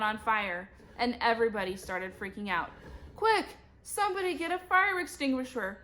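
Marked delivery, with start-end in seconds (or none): 3.2-5.8 urgent high-pitched yell. A narrator's reading. Principal calling for a fire extinguisher, yelling. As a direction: call out urgently in a raised pitch. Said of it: "Mister Klutz yelled".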